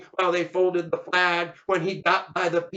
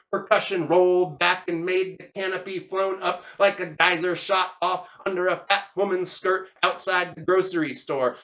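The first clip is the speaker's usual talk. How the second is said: plain reading voice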